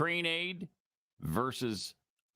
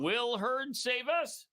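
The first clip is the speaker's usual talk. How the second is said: drawn-out, higher pleading question; slow mock plea; 11 semitones higher, slower than usual. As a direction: ask it slowly like a hopeful fan.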